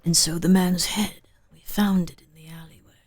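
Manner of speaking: low whispered horror narration; hushed, ominous narrator whisper; creepy whisper. Retold or imitated. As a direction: whisper it like a spooky audio drama. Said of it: "I didn't wanna crunch during the Magnus Archives".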